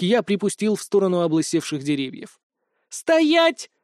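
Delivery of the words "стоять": very high, sharp command; barked order. A narrator's reading. Nastya halts the fleeing hero, barking. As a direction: bark it out high and sudden. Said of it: "рявкнула Настя".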